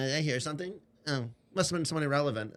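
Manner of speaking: a touch of fake-dismissive airs; mock aloof. Imitating Tony pretending not to hear, mock snooty. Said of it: "pretends like he can't hear me".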